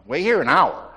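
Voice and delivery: emphatic angel's command; voicing the angel. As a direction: call it out firmly as the angel. Corrects himself, angel's command.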